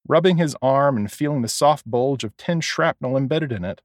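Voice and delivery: ordinary delivery, nothing marked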